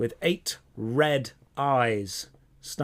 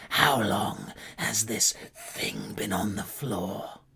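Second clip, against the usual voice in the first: slow, softer menacing guard voice; suspicious spider guard; 4 semitones lower, 5 dB quieter, slower than usual. Acted. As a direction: question the prisoner with slow suspicion.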